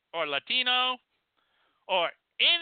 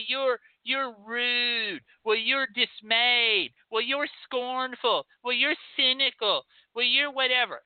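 lightly mocking, sing-song lecturing; mocking positive thinker; 4 semitones higher than usual. Comic mocks people blaming your attitude, mocking sing-song. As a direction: parody a smug advice giver telling you off. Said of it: "people who will tell you that".

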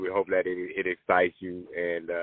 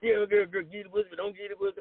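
high mock whine; 10 semitones higher than usual. Imitating [name]'s complaint.

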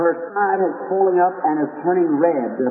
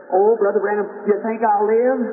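put-on high, eager young girl's voice; 5 semitones higher than usual. Sick girl tells preacher she believes, eager and high. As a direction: pitch it up like a hopeful sick girl.

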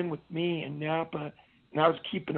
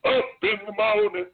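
higher, forceful imitated cadence; imitated running-formation call; drill sergeant cadence call; 4 semitones higher, 4 dB louder than usual. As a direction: call it out like a sergeant leading a run. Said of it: "like that".

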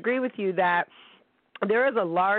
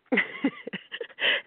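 high burst of laughter; amused laugh. Real, not acted. Host laughing at her own retold story, amused laugh. Laughs after retelling the question.

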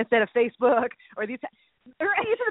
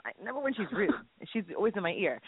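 ordinary talk, nothing marked

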